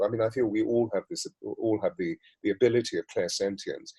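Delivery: ordinary talk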